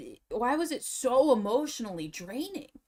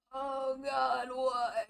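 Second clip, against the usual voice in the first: ordinary talk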